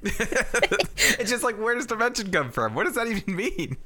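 high laughing bafflement; laughing disbelief. Real, not acted. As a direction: laugh through the question.

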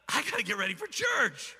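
high-pitched, frantic retold outburst. Preacher reliving a chaotic moment before church, frantic high outburst. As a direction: burst out in mock panic, pitch jumping up.

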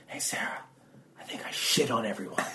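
hushed confession in a stage whisper; acted furtive whisper. Peeking out to confess to a friend, furtive whisper. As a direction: whisper it through a cracked door. Secretly calling friend through cracked door.

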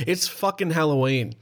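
usual speaking voice

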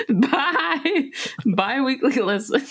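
fighting back giggles; stifling a laugh mid-sentence; trying not to laugh. Real, not acted. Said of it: "stop making me laugh".

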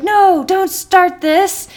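high, loud, slow mock pleading; hammed-up panicked protest; imitated exasperated plea. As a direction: drag it out in mock dread. Acts teacher pleading with crying kids.

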